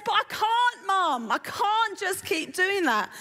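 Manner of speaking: put-on high, protesting Essex accent; Rose. Rose protesting to her mum, Essex accent. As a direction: plead with Mum as an Essex daughter. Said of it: "And Rose says".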